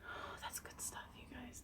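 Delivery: hushed whisper after a sip of coffee. Real, not acted.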